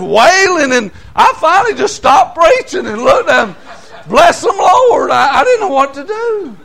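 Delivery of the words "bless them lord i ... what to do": exasperated blessing. Real, not acted. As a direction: throw up your hands and cry it out. Preacher stunned by wailing ladies.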